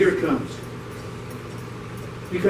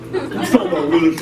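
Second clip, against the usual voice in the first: sitcom catchphrase impression; 6 semitones lower, faster than usual. Shown as a demonstration. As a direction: lower take on the Willis line. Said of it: "How'd he go?".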